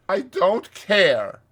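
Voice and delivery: slow, loud, stubborn refusal; dogged. A narrator's reading. Rat refusing Toad's trip, dogged. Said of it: "said the rat doggedly".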